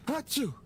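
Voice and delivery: loud, high-pitched sneeze. Acted. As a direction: let out a big sneeze. Character sneezes.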